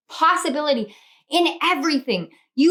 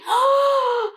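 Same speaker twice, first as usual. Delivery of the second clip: gasp; 11 semitones higher than usual. Real, not acted. Shocked by the card.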